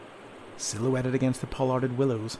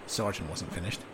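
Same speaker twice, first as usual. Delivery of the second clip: ordinary delivery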